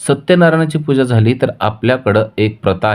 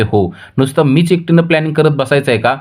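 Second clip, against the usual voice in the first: much higher, heated protest; angry; 9 semitones higher than usual. Acted. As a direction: throw the question back at him, fuming. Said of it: "Vijaya चिडते आणि म्हणते".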